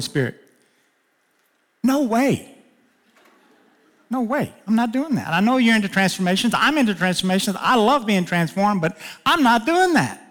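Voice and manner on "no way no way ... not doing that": high-pitched mock protest, digging in; stubborn refusal. Preacher retelling his refusal to the Holy Spirit, high protest. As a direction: play the boy flatly refusing God, pitch up.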